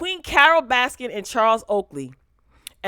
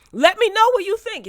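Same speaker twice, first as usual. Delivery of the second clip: much higher and louder, "me" pushed up; excited plea; 13 semitones higher, 8 dB louder than usual. Real, not acted. Vlogger to viewers, urging comments excitedly. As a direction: sing out "let me know" to the viewers.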